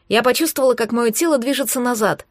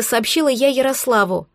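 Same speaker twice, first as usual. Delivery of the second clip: plain reading voice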